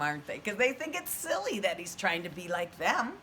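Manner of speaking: plain reading voice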